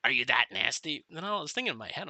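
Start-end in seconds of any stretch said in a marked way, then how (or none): 0.0-1.0 louder scolding yell. Retold or imitated. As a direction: chew him out loudly. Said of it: "He was yelling at the recruit".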